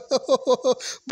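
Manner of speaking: a short burst of real laughter. Real, not acted. Laughing at the car jumping like a monkey, real laugh. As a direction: laugh it out, can't hold it in. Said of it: "मुझे तो बहुत ज़्यादा हंसी आ गई यार".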